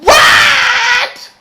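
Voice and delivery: high, loud shocked shriek; shocked what. Retold or imitated. Reacting to the shooting, high shocked shriek. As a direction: screech it in disbelief. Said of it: "I was like".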